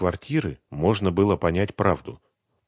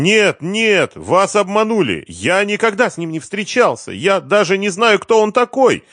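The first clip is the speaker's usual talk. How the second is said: high, loud desperate voice; frightened protesting; panicked denial; 14 semitones higher, 8 dB louder than usual. A narrator's reading. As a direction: deny it frantically, pitched way up. Cornered man denies the accusation.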